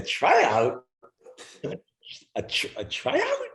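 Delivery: stunned double take; retold disbelief. Ziggy stunned to hear he's only on a tryout, disbelieving. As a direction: pitch shooting way up, slow.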